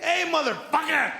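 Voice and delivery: angry shout at a car that cut you off; dad yelling at the other driver in traffic, furious; road rage yell. Retold or imitated. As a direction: yell it high and loud at the driver. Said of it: "someone cuts you off, and you're like".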